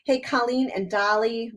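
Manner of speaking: normal talking voice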